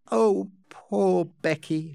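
pitying. A narrator's reading. Amelia hearing of Becky's hardship, slow and pitying. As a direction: draw it out with pity.

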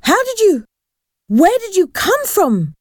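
high-pitched, horrified questioning. A narrator's reading. Boy jumps at the girl watching them, horrified. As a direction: stammer out the question in high alarm. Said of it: "Paul jumped in horror".

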